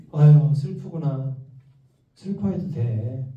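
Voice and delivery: put-on tender parent easing a child's sadness; soothing comfort. Shown as a demonstration. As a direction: comfort a sad child slowly. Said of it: "이렇게 얘기해 주시면 돼요".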